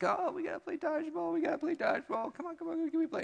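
put-on high, eager child voice begging; high excited kid begging; pleading kids. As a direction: squeak like a kid begging to play. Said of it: "my kids are just like".

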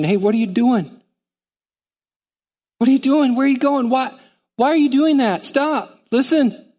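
urgent high questioning calls; the Spirit calling a wanderer back; Holy Spirit questioning. Acted.

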